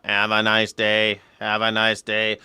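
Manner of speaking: slow, lightly robotic repeated greeting; flatly loop it like a machine. Retold or imitated. Comedian imitating a robot store clerk, mild robotic drone. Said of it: "replaced, right, by a robot".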